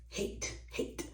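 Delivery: breathy whispered disgust. Real, not acted. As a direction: whisper the repeated word. Disgust at the tray.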